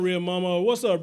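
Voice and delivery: normal talking voice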